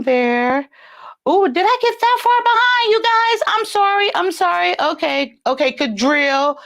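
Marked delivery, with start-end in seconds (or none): 1.3-4.8 high, squeaky flustered apology; oops, high-voiced and flustered; flustered surprise. Real, not acted. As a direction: rush out an apology in a high pitch. Flustered at falling behind the chat.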